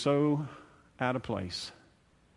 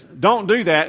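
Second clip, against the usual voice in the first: loud, higher "don't do that"; mock parent scolding; 6 semitones higher, 10 dB louder, slower than usual. Parent warning a child, louder and higher. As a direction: bark it like a stern parent.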